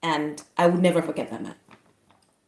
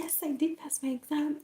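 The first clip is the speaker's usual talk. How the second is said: tag gentle reassurance; 10 semitones higher, 7 dB quieter than usual. Retold or imitated. Retold sharing news with frail man.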